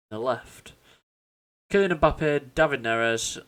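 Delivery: ordinary delivery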